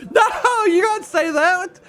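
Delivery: high, loud shriek of laughing disbelief; shocked laugh. Real, not acted. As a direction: squeal in outraged delight. Shocked at the NPC joke.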